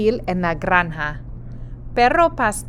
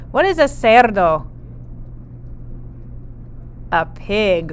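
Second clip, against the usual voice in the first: ordinary talk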